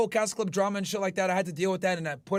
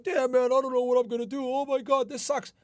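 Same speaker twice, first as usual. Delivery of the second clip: lightly put-on higher voice of a moper; mock a self-pitying guy, a touch higher; mock sulky whiner; 5 semitones higher than usual. Mocking viewers who sulk over hardship, put-on mopey voice. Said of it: "Don't be like".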